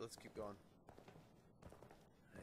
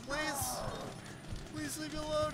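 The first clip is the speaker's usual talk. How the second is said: frightened pleading; 8 semitones higher than usual. Real, not acted. Begging the monster to leave.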